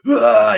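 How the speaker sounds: sudden loud open-mouthed shout; short shout. Shown as a demonstration. Demoing a singer's yell to his co-host, loud shout. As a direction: belt a short shout straight out. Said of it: "but he's not being like".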